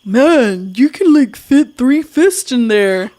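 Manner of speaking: a hint of a crude guy voice; imitating husband. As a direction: mimic her husband's blunt remark slowly. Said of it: "He said".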